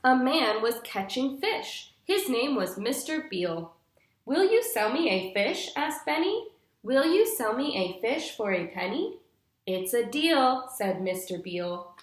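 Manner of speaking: bright, clear storybook reading for a class; patient storytime narration for young listeners; reading to children. Acted. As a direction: read it like a picture book to little kids. Teacher reads picture book aloud.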